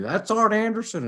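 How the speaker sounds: high excited announcer call; imitating J R. JR calling the run-in, mock excited call. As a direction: do J R losing it on commentary. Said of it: "you know how J R went".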